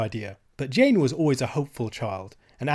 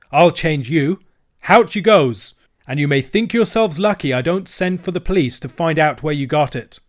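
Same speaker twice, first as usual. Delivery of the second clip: loud, higher, bullying shopkeeper; angry threat; 4 semitones higher, 7 dB louder than usual. Acted. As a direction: throw them out of the shop.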